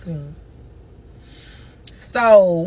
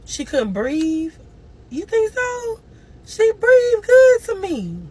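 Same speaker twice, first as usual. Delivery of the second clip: distressed; 13 semitones higher, 4 dB louder, slower than usual. Real, not acted. Disbelieving cry.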